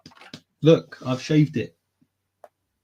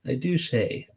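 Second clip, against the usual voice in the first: softer put-on British accent; a quieter echo in mock British; 6 dB quieter, slower than usual. Shown as a demonstration. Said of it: "I just read that in a British accent".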